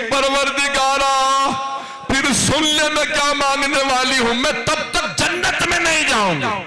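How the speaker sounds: high, crying lament to God; a grieving mother begging heaven, weeping; weeping plea. A narrator's reading. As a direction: cry out to God through tears. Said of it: "मेरी दादी एक मर्तबा रो के कहेंगी".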